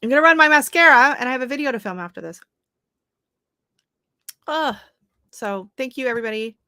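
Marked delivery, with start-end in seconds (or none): 0.0-4.8 fighting back tears; choked up. Real, not acted. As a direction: voice tight with tears. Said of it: "I'm getting all choked up".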